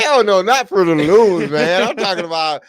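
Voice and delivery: loud, high protest; emphatic protest. Real, not acted. Player loudly rejects cheap underwear brand, loud. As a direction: shout it down indignantly.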